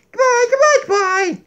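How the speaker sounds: high, loud puppet goodbye; Donkey's goodbye. Acted. Donkey waves goodbye to viewers, high puppet voice. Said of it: "Donkey says goodbye".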